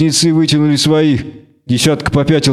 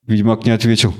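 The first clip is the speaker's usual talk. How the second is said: ordinary delivery, nothing marked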